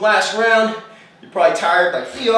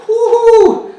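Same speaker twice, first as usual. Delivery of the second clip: excited cheer; 14 semitones higher, 8 dB louder than usual. Real, not acted. Cheering end of plank round.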